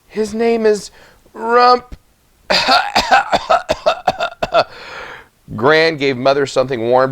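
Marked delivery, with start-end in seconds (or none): 0.1-2.0 much higher, far-off voice of a failing mother; dying mother. A narrator's reading. Dying mother names her baby. 2.5-4.6 a thin, breathy dying cough; weak cough. A narrator's reading. Fevered mother coughing.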